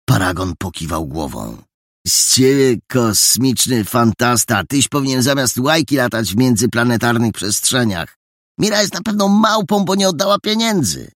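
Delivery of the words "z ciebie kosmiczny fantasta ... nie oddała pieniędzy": mocking. Paragon mocks friend's fantasy.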